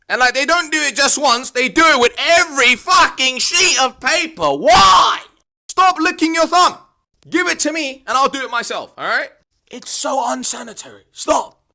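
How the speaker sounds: high, loud, fed-up yelling; exasperated rant. Acted. Student fed up with a thumb-licking teacher, loud exasperated rant. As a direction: shout it like you can't take it anymore.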